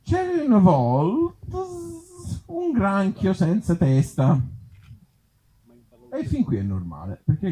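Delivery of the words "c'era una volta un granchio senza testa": slow, high fairy-tale opening; once-upon-a-time reading; storybook voice. Acted. Comic reading a fairy tale to the crowd, slow and high.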